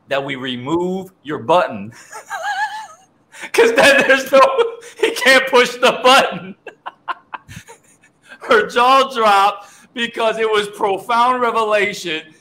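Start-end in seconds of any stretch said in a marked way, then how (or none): 2.7-12.3 high, helpless laughing through the words; real laughter. Real, not acted. Host retelling the pastor's joke, laughing hard. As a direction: crack up as you explain it. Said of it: "So I'm laughing".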